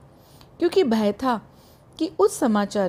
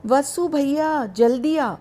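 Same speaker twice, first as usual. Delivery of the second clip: drawn-out, slightly raised call to a brother; joyful call; 4 semitones higher, slower than usual. A narrator's reading. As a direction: call out happily, stretching the name, as a narrator would. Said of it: "आनंद से हाथ उठाकर मुझको जोर से पुकारा". (आनंद से हल्की पुकार)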